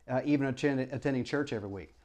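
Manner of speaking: ordinary delivery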